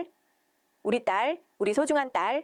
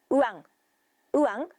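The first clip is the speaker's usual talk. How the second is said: imitated baby cry. A narrator's reading. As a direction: let the baby's cry ring out in bursts. Voiced newborn crying.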